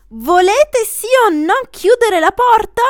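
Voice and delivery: high, loud irritated demand; exasperated order to close the door; impatient demand. Acted. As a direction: snap at the crowd.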